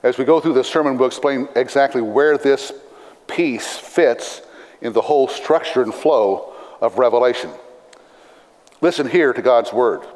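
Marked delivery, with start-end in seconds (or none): none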